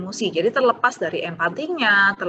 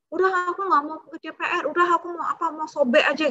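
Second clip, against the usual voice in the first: put-on high, petulant child refusing homework; mock angry child; 8 semitones higher than usual. Teacher voicing a child who refuses homework, high and petulant. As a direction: whine like a kid fed up with homework.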